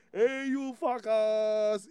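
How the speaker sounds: high, slow, drawn-out holler to friends; rowdy local greeting. Retold or imitated. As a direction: call out to the gang, stretching each word. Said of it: "other places where you can".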